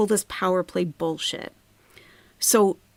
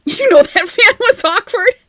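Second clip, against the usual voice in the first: squeaky joke; 13 semitones higher, 11 dB louder than usual. Real, not acted. Joking aside, high pitched.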